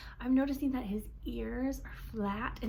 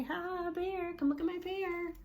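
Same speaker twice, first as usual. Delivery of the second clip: fake friendly; 7 semitones higher than usual. Shown as a demonstration. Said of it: "put on a fake smile".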